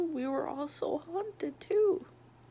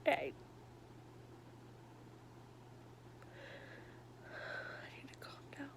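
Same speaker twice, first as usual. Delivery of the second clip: softer crying whisper; ugly crying; 14 dB quieter, much faster than usual. Real, not acted. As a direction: choke the words out while crying. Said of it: "sorry for the ugly crying".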